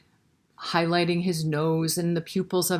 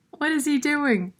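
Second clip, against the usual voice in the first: curious question; 9 semitones higher than usual. Acted. Reader voices curious child.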